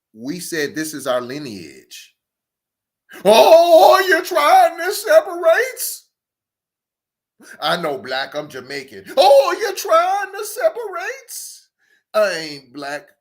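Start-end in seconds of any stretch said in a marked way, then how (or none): 3.2-6.0 high, loud, slow mock outrage; mocking outraged voice. Mocking voice of other side. 9.1-11.7 high, loud, slow mock outrage; mocking outraged voice. Mocking voice of other side.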